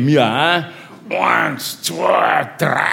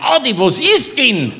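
puzzled; 9 semitones higher than usual. Retold or imitated. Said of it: "denke ich mir".

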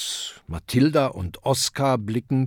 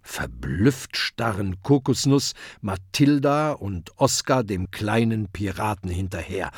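usual speaking voice

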